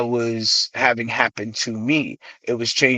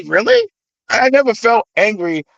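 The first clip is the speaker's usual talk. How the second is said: higher, incredulous protest; surprised reply; 10 semitones higher, 3 dB louder than usual. Retold or imitated. Artist answers people who called the album angry, incredulous. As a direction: act out genuine disbelief on a raised pitch.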